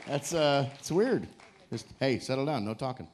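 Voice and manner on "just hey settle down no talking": ordinary delivery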